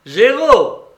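loud, high announcement; croupier calling out. A narrator's reading. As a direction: call the result out across the table. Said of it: "appela le croupier". (annonce forte et aiguë de croupier)

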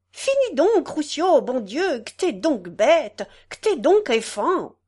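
mock-scold the friend; wife scolding. Gatelier voicing his wife scolding Roussio, lightly. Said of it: "la gatelière disait tout le temps".

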